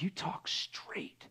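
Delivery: slow, soft whisper; hushed retelling. Retold or imitated. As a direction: breathe it like a secret. Retells lady's compliment.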